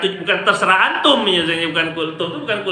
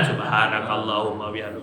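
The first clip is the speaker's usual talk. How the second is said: quiet closing prayer; 9 semitones lower, 6 dB quieter than usual. Retold or imitated. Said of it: "ujungnya ditutup dengan".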